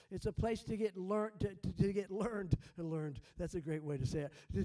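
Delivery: normal talking voice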